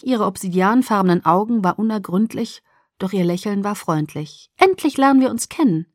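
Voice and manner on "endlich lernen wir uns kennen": high soprano voice. A narrator's reading. Alice greets Bella at last, high soprano voice. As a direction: lift into a bright high soprano, friendly. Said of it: "ihre hohe Sopranstimme".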